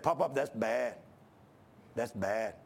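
a lightly voiced small boy, low and soft; hint of a child tut-tutting his grandpa; little grandson scolding. Little boy tells grandpa his cigar is bad, softly scolding.

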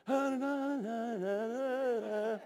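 high, soft, drawn-out imitated whimper; mock distressed wail. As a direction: wail wordlessly as if unable to bear it. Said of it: "I'm outside going".

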